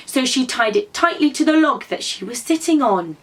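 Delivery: ordinary delivery, nothing marked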